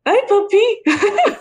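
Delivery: high drawn-out puppy talk, breaking into laughter; puppy greeting. Real, not acted. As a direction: coo it high to a dog. Greeting a dog mid-chat.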